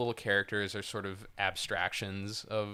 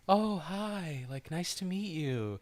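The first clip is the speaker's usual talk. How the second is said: put-on high, sing-to-the-kids teacher voice; easygoing preschool-teacher impression, higher than usual; 7 semitones higher, slower than usual. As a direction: greet them like a preschool teacher, pitched up. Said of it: "they're like preschool teachers".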